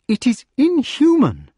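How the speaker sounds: a lady's indignant outburst; vexed. A narrator's reading. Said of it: "she said in a vexed way".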